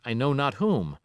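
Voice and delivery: usual speaking voice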